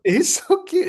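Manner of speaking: high squeaky he's so cute; mock cutesy echo. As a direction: squeak it like a doting aunt. Echoing the cute remark, mock.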